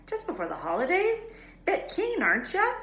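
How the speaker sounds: high, playful teasing voice; teasing Hagrid. A narrator's reading. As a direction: tease them fondly.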